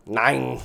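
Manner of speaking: Nine! punched hard and falling; emphasized nine. Shown as a demonstration. Streamer repeating his count, mock German stress. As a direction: hit the word Nine like a German command. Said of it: "I did really emphasize the word nine".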